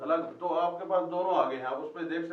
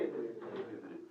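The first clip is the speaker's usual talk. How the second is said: 1 slow demo; 8 semitones lower, 13 dB quieter, much slower than usual. Shown as a demonstration. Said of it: "क्या बनेगा जी?".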